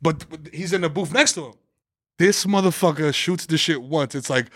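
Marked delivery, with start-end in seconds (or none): none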